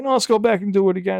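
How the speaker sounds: high, fast, loud mimicry of an artist; insistent artist. Artist overrides his reassurance.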